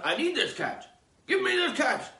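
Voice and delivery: high, insistent demand; demanding quote. Acted. Reply demanding the cat, high and insistent.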